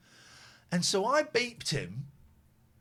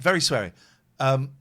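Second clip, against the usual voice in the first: normal talking voice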